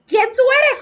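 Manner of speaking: high, loud, startled "who are you?"; mock frightened shriek. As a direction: shriek it like you've seen a stranger in your room. (grito fingido de susto)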